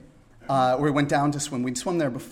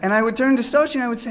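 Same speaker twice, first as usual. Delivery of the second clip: usual speaking voice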